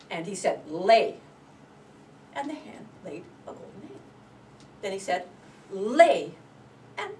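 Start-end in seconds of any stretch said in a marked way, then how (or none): 0.7-1.2 loud one-word order; giant's command. Acted. The giant ordering his hen, loud command. 5.7-6.4 loud one-word order; giant's command. Acted. The giant ordering his hen, loud command.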